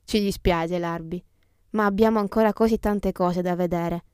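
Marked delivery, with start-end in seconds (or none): none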